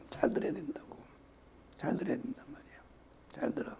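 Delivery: urgent whispered warning; hushed insistence to listen. Real, not acted. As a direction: whisper it like a secret to the congregation. Preacher drops to whisper.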